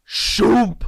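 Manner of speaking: loud high whoosh sound; sliding sound effect. Retold or imitated. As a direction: make a quick shoomp sound.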